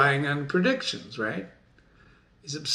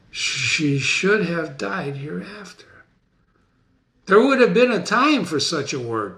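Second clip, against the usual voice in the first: grieving Macbeth; 3 semitones higher than usual. Acted. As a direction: declaim it like a king who lost everything. Dramatic reading of Macbeth soliloquy.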